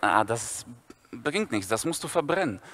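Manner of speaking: low murmuring schoolboy; Viktor's voice. Retold or imitated.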